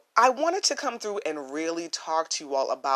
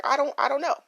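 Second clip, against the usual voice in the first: higher, offhand I-don't-know; shrugging reply; 7 semitones higher, 4 dB louder, much faster than usual. Retold or imitated. Teen [name] answers nervous classmates, offhand. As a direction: shrug it off lightly. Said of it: "I would kinda just be like".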